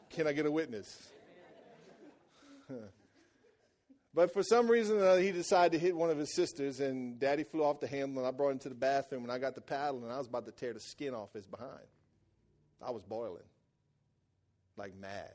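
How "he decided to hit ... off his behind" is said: ordinary delivery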